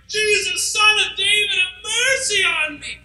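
high, pleading shout, slowing on have mercy on me. Acted. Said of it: "he yells even louder".

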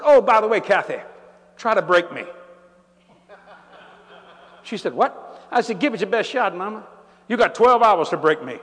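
hammed-up teasing bravado; playful dare. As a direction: egg her on with swagger. Retold playful challenge to wife.

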